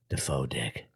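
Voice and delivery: low, quiet, stunned mumble of the words; dazed traumatized. Retold or imitated. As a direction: say it like you're still in shock. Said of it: "Traumatized I was".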